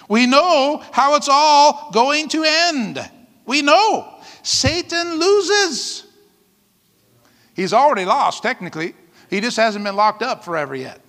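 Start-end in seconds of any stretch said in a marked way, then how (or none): 0.1-6.0 rising, slow emphatic proclamation; proclaiming. Real, not acted. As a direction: declare it triumphantly to the church. Preacher proclaiming victory.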